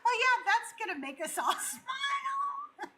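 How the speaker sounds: high, laughing mock cheer; sarcastic. As a kid mocking her angry dad's photo demands, laughing sarcastically. Sarcastic childhood thought about dad.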